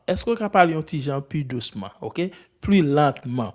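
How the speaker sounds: usual speaking voice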